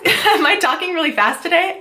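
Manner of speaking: high, giggly self-conscious question; laughing. Real, not acted. Vlogger to viewers about her fast talking, laughing. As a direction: ask it through a breathless laugh.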